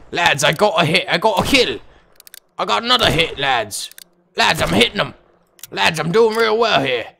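excited British soldier calling out; mock British accent. Soldier telling his lads about hits, mock British accent. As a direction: report to the troops in a put-on British accent.

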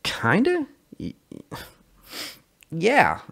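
sheepish. Acted. Sully sheepish reply.

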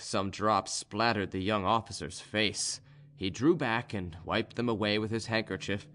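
ordinary delivery, nothing marked

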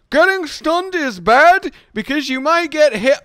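high, loud, stretched-out protest; mock indignant shout. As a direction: yell it slowly, word by word. Mock-explains while getting hit.

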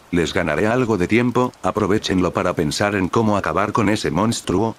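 plain reading voice

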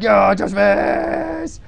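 slow then high, loud yell; mock shout. Voicing the drawing with a loud high shout. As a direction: scream it as the doodle. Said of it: "he's like".

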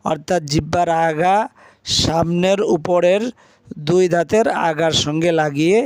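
usual speaking voice

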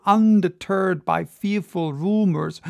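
ordinary delivery, nothing marked

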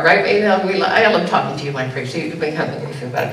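ordinary delivery

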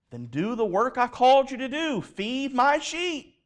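high, emphatic charge, slowing at the end; urgent command. Retold or imitated. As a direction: press the command home as Jesus. Jesus commissions Peter.

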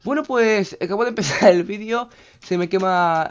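loud, high-pitched outburst of disbelief; exasperated recap. Real, not acted. Gamer to viewers after house burns, loud exasperation. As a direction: burst out loudly, summing up the mishap.

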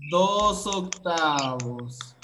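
Dos octavos spoken very slowly, syllable by syllable; slow teacher correction; slow modelling. Shown as a demonstration. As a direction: spell the answer out slowly for children.

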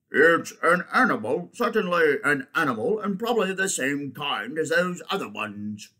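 insistent bulldog. Acted. Bulldog figure in story.

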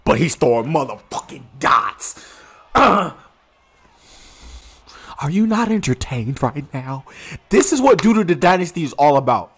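rally the crowd like a gladiator; gladiator hype speech. Pretend. Hyping the stream audience about the dynasty, booming arena speech.

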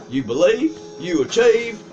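folksy hillbilly guru voice; hillbilly accent. Pretend. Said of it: "Why are you talking in a hillbilly accent".

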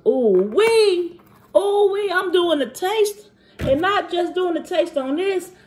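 put-on high, excited kid voice; seven-year-old self. Telling viewers about the taste test, excited pretend kid voice. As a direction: get giddy and high like a child. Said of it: "transitioning to my seven-year-old self".

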